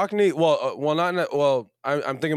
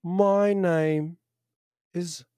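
higher, put-on Cockney; Cockney accent; 6 semitones higher, much slower than usual. Shown as a demonstration. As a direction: attempt a Cockney introduction. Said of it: "Can you do a Cockney?".

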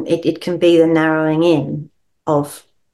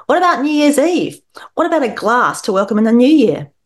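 tipsy dad; 6 semitones higher, 4 dB louder than usual. Acted. Tipsy dad in read story.

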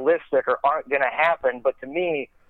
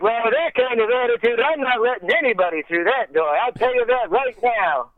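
Norm Macdonald voice; 7 semitones higher than usual. Retold or imitated. Norm voiced, mock stern.

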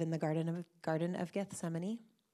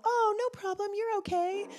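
put-on much higher, lilting voice as if calming a toddler; sing-song reassurance, pitched way up; soothing a small child; 5 dB louder than usual. Parent comforting a little child who fell, high and soothing. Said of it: "from when they're really little".

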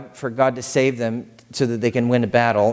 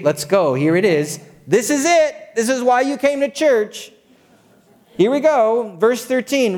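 rousing preaching; 8 semitones higher than usual. Acted. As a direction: lift them up out of their seats.

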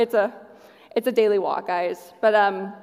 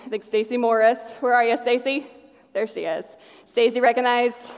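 ordinary talk, nothing marked

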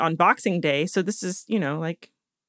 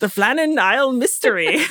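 hammed-up Scottish accent, higher pitch; Shrek; 4 semitones higher, 4 dB louder than usual. Host reading the title like Shrek, mock Scottish accent. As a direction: say it in a playful Scottish brogue. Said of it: "just think of Shrek".

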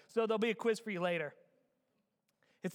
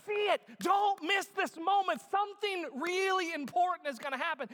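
high, raised urgent appeal; urgent call; 10 semitones higher, 5 dB louder than usual. Acted. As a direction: preach it like an alert. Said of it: "it's this idea".

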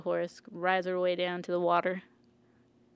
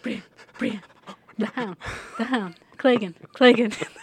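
rising to a louder, higher alarm; panicked warning; 3 semitones higher, 7 dB louder than usual. Retold or imitated. Podrick warns Brienne about the Hound, mock panic building up. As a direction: warn her, getting more frantic with each name. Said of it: "Podrick's like".

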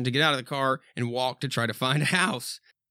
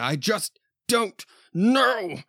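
high, slow, drawn-out despair; helpless doctor; 8 semitones higher, 3 dB louder, much slower than usual. Acted. Doctor admitting defeat to the father, slow and high. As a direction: drag it out hopelessly.

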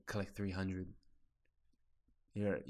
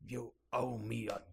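put-on Scottish accent. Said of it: "do it in a Scottish accent".

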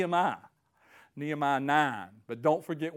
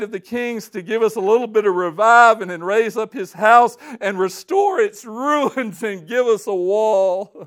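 much louder, higher, fervent; passionate scripture reading; 5 semitones higher, 12 dB louder than usual. Acted. Preacher reading Ezra's prayer with fervor. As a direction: proclaim it like a triumphant cry.

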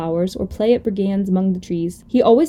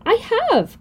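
high-pitched little protest; indignant cry; 13 semitones higher than usual. Acted. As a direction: cry it out in defence. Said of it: "cried the little rabbit".

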